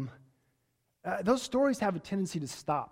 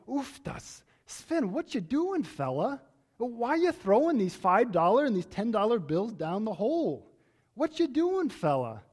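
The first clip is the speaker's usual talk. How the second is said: put-on high, comic Norwegian farmer accent; Ole's Norwegian accent; 4 semitones higher than usual. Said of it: "And Ole says to Sven".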